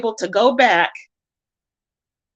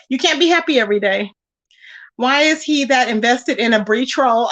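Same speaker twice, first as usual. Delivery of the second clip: usual speaking voice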